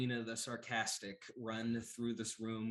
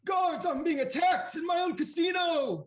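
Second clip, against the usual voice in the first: very high, loud outraged cry; yelling for guards; 11 dB louder than usual. Acted. Casino boss calling his guards, high outraged yell. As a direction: shriek for help like a panicked crime boss. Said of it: "and he yells out".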